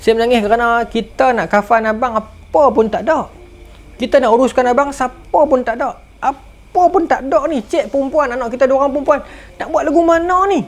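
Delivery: wife frets over the burial, tearful; grieving wife. Acted. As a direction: plead as the worried widow-to-be. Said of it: "Tapi isteri dia kata".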